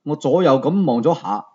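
plain reading voice

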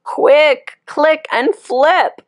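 high, loud and urgent, then slowed; urgent cry. Acted. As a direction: call out to save the young one. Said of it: "cried the wise old click beetle".

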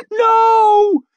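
very high, loud, stretched scream; terrified squeal. A narrator's reading. Maid as the mob drags her inside, squealing. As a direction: squeal in horror. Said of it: "she squealed".

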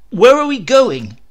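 high, loud frightened plea; Ray begs to know where he is; shaky question. Acted. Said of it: "it was a shaky question".

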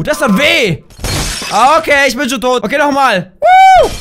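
ordinary talk

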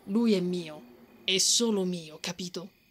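firm and territorial; possessive claim. Acted. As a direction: stake your claim, word by word. Claiming him before the others.

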